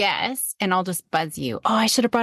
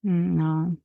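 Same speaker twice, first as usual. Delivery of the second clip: ordinary talk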